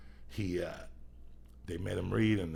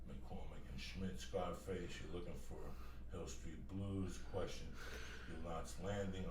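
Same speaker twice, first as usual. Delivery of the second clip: distant, much softer off-mic talk; away from the microphone; 10 dB quieter than usual. Real, not acted. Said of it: "walking away from the microphone".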